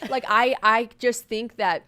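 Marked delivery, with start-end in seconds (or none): none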